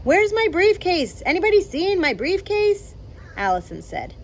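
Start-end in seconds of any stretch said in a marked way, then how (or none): 0.0-2.9 calling from upstairs. Acted. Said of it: "called from upstairs".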